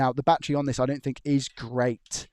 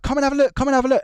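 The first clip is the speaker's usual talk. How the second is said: much higher and louder, calling a coworker over; urgent call over; 12 semitones higher, 7 dB louder, faster than usual. Retold or imitated. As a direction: summon the team leader in alarm. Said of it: "he'd be like".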